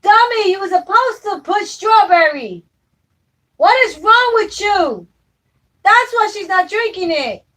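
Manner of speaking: high, loud exasperated scolding; scolding yell. Real, not acted. To Nathan about the baby's milk, a loud scolding yell. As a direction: yell at him for getting it wrong.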